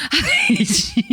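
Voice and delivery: high, slow, through laughter; laughing. Real, not acted. Co-host laughing at the slap story, mock-hating. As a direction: laugh the words out in delight.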